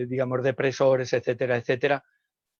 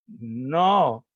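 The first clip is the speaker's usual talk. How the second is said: put-on a louder, insistent child no; child's firm no; 5 dB louder than usual. Shown as a demonstration. Child rejecting the wrong name, firm no. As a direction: protest like a corrected kid. Child protests adult echo.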